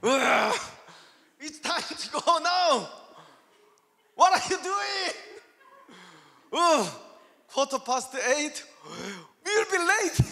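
hammed-up impatient complaint; angry. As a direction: re-enact fuming at a late spouse. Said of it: "I'm getting angry".

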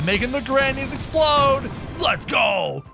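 normal talking voice

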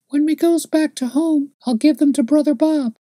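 put-on high eager child's voice; little girl. A narrator's reading. Little girl plans a gift for Brother Bob, high child voice.

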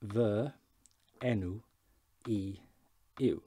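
"Fy enw i yw" slow, each word apart; Welsh, word by word. Shown as a demonstration. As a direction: say "Fy enw i yw" as vuh en-oo ee yoo, pausing between words. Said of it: "We can use the formal way".

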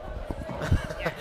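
quiet airy giggle; soft breathy laugh. Real, not acted. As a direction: let out a hushed laugh. Laughing at the Bulma joke.

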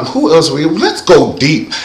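normal talking voice

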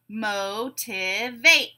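"Motivate" very slowly, mo-ti-vate; three drawn-out separate beats on "Motivate"; syllable by syllable. Shown as a demonstration. Teacher breaking a word into syllables, beat by beat. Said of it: "we'll break it into syllables".

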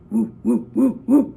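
loud hooting animal call; monkey hoots. Acted. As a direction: hoot like an animal.

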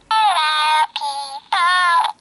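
a gentle squeak; light squeaky bird. Pretend. Kid voicing her toy bird lightly. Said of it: "squeaky voice like this".

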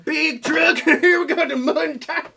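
hammed-up loud Southern accent. Mocks Southern accent with whoops.

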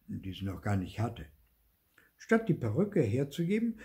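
usual speaking voice

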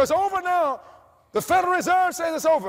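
high, loud mock of complacent people; mocking the crowd. Preacher mocking people who believe the crisis passed, high and loud. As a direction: sing it out like fools who think it's over. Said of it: "They say".

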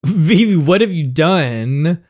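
high, loud drawn-out scolding; mock scolding. Friend confronts Vivi over the girl in the backseat, mock scolding. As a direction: exclaim it in playful reproach.

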